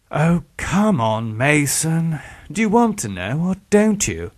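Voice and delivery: high, impatient coaxing; exasperated sigh. A narrator's reading. Villain urging his victim to take his hand, exasperated. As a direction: coax him with weary exasperation. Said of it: "De Vere sighed".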